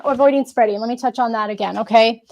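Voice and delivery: ordinary talk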